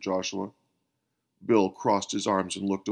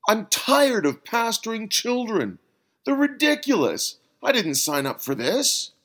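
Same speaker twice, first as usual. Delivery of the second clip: 1 frustrated rant; 12 semitones higher, 3 dB louder than usual. A narrator's reading. Bill venting frustration.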